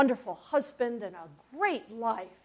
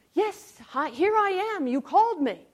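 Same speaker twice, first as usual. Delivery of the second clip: put-on higher, louder, eager child's answer; a breathless young boy reporting for duty; 8 semitones higher, 7 dB louder than usual. Boy runs eagerly to Eli.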